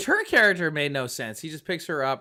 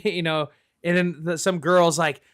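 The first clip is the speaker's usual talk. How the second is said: ordinary delivery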